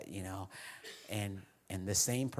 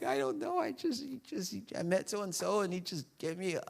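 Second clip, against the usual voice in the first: much higher, breaking with emotion; overcome; 10 semitones higher than usual. Retold or imitated. Pastor telling his wife on the phone what happened, voice breaking high. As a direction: fumble for words, choked up.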